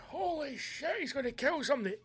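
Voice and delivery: high alarmed onlooker voice; shocked onlooker. Retold or imitated. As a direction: play a scared bystander. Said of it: "or fucking".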